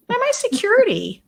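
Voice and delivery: high childish mock voice; imitating toddler. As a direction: squeak it like a little kid.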